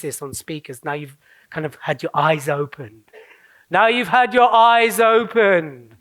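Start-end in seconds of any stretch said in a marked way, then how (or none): 3.7-6.0 slow, hammed-up grand announcement; big, drawn-out dramatic revelation; theatrical proclamation. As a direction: draw it out like a mock prophet. Hammed-up repeat of own line.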